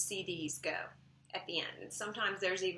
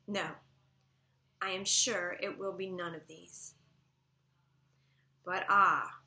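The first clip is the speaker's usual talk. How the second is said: louder, measured poetry recitation; poem read aloud; 6 dB louder than usual. Acted. Teacher reading a sonnet to the class, projected poetry recitation. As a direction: recite it strongly, leaning into the key words.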